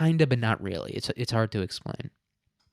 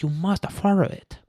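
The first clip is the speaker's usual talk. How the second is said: mock Chinese accent; 8 semitones higher than usual. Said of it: "He was like".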